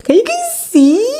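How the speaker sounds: high, loud thrilled squeal to the viewers. Real, not acted. Showing the book page to viewers, a high loud thrilled squeal.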